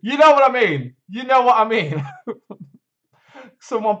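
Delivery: laughing. Real, not acted. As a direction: blurt it out through real laughter. Laughing at his own blunder.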